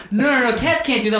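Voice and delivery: high-pitched retold protest; incredulous denial. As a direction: imitate a partner refusing to believe it. Acting out wife's disbelieving denial.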